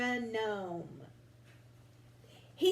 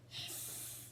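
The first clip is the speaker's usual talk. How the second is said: soft unvoiced hiss of the paint box; a faint hissing sound; whispered hiss; 17 dB quieter than usual. A narrator's reading. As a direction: breathe out a quiet hiss.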